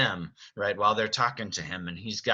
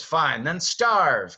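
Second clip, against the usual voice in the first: raised, loud, drawn out; slower re-enacted angry yell; Beast's angry outburst; 6 semitones higher, 6 dB louder, much slower than usual. Director recaps Beast leaving Belle's door, angry. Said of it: "He says".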